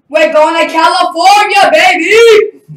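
high, very loud victory yell; triumphant shout. Retold or imitated. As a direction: shout the big news, soaring on the last word. Retells announcing his win to dad.

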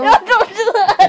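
laughing while being tickled. Real, not acted. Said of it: "You're tickling me while I'm recording".

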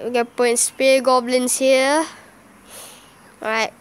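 ordinary talk, nothing marked

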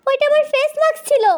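high-pitched, aghast protest; acted high squeal of dismay; horrified outcry. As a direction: cry out in comic horror. Horrified at misused face mask.

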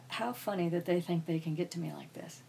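amused inner thought, whispered. Retold or imitated. Recalling her bullies, whispered amused thought. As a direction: breathe it as a secret.

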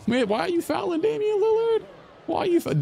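sudden very high squeak of disbelief; outraged squeal. Real, not acted. Gamer shrieks at his player for fouling Lillard, very high. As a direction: shriek it in shock.

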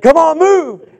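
loud, high shout to move; yelling coach. Retold or imitated. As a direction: yell at the kid to hurry. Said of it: "I said".

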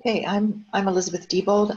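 ordinary delivery

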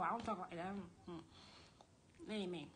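low, muttered denial; low aside. Real, not acted. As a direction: drop the act and disown it under your breath. Drops the mock voice, disowning it.

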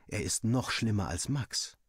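plain reading voice

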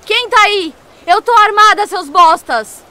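high, loud defensive yell; scared threatening shout. Acted. Clara alone in the dark warning the intruders, loud frightened shout. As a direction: shout to scare off whoever is there.

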